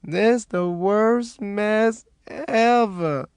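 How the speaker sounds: high, slow moan. A narrator's reading. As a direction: drag the words out in a moan. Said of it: "moaned under her breath".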